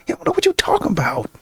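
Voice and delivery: ordinary talk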